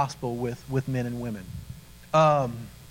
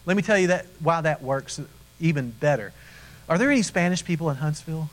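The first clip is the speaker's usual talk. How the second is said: ordinary talk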